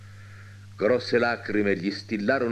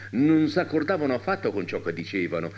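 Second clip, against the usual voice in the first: ordinary delivery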